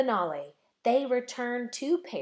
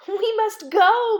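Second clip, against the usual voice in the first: high, bright-eyed eager burst, much louder; laughing excitedly; 11 semitones higher, 10 dB louder than usual. A narrator's reading. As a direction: cry out eagerly like an excited girl. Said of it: "Mirette laughed".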